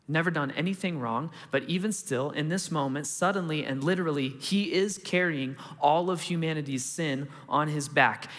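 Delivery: usual speaking voice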